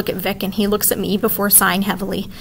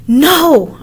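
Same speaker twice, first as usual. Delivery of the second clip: sharp loud cry of no; 7 semitones higher, 9 dB louder than usual. A narrator's reading. Narrator crying out as Kavita opens the tin. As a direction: shout it in alarm. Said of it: "I cry".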